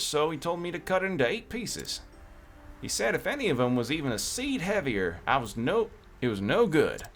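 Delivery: put-on a light country cowboy accent for the merchant; lightly voiced country cowboy merchant. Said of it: "the reason why I'm doing the country accent".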